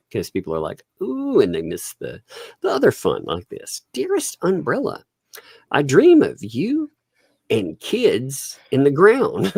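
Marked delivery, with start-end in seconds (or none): 1.0-1.4 high-pitched mock "ooh"; drawn-in "ooh", put on; imitated distracted reaction. 3.9-9.5 slow, high-pitched mock love letter; hammed-up recital with long pauses; theatrical letter reading. Reads odd message aloud theatrically.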